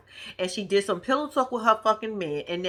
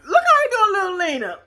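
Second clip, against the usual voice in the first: exasperated; 9 semitones higher, 5 dB louder than usual. Real, not acted. Exasperated about high five.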